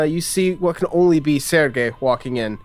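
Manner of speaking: normal talking voice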